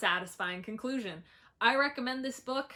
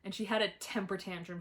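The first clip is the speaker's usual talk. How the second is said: ordinary delivery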